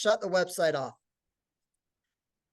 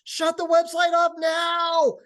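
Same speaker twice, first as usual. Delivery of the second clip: imitated shout, much higher and louder; yelling; 12 semitones higher, 7 dB louder, slower than usual. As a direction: yell it as a drawn-out order, mimicking an irate partner. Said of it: "yelling at me".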